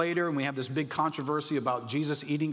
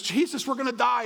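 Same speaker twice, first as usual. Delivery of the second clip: much higher, louder mock panic calling to Jesus; panicked plea; 10 semitones higher, 6 dB louder than usual. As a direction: shout it like a terrified fisherman waking his master. Disciples waking Jesus in storm.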